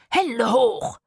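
loud, forceful order; commanding shout. A narrator's reading. Itzik aiming at the quarrelling adults, commanding shout. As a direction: shout it like a stick-up. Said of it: "rief er".

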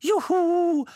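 high, loud joyful squeal; jubilant whoop. A narrator's reading. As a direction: whoop it out high like a delighted lady. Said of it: "jauchzte Miss Mistletoe". (hoch und laut jauchzen)

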